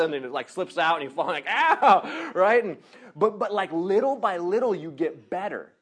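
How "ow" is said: high, loud "ow"; mock pained yelp. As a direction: cry out as if hitting the ground. Imitates falling off skateboard.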